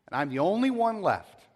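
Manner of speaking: high, loud cry of being alone; loud lament. Acted. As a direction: throw it out in despair. Elijah's lament, first take.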